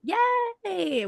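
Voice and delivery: a high squeal of delight; excited cheer. Real, not acted. Host celebrating the guest's excitement, high squealed yay. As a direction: cheer her right back.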